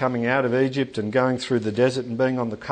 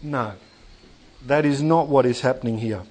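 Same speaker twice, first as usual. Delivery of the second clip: usual speaking voice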